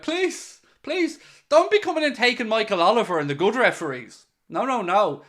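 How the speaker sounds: high-pitched, hammed-up begging; play-acted imploring; mock inner pleading. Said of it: "'Cause inside I'm hoping".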